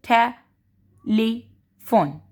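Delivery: "Telephone" very slowly, te... lee... fone; long gaps between the three syllables of "telephone". Shown as a demonstration. Teacher demonstrating the chin test, "telephone" in separated syllables. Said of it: "आप बोलेंगे टे".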